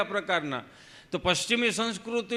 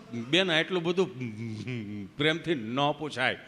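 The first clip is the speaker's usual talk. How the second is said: lower, halting stammering friend; Gabho, tongue-tied; 6 semitones lower, 4 dB quieter than usual. Retold or imitated. Friend answering the nurse at vaccination, lower halting stammer. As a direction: drag the words out like a tongue stuck in place. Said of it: "જીભ જલાય સોંટે અટકે".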